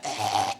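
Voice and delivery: low strangled croak; choking croak. Shown as a demonstration. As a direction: gag out a low choking noise. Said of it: "that noise, you know that noise".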